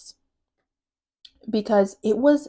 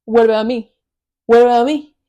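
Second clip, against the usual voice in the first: louder, needy what about me; pleading boy; 2 semitones higher, 10 dB louder than usual. Retold or imitated. Rival boy demanding her attention, loud and needy. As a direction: beg to be picked, pushing forward. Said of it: "he's like".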